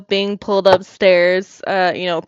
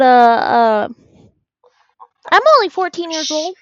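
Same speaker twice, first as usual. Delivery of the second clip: put-on chirpy fourteen-year-old; 2 semitones higher than usual. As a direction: play the innocent kid who just wants fun. Player voices young Talissa.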